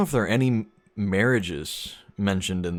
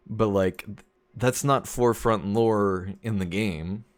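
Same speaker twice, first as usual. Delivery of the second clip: ordinary delivery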